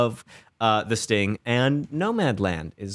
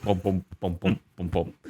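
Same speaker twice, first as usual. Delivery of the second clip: beatbox boom; 7 semitones lower, 5 dB quieter than usual. Pretend. Sign-off beat.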